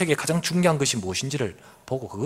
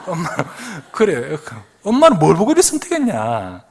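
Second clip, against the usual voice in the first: put-on teasing kids; 5 semitones higher, 4 dB louder than usual. Kids teasing about dad's photos.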